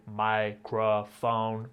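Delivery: microphone, slow robotic syllables. Shown as a demonstration. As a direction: microphone chopped into three machine beats. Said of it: "talk like this robot voice".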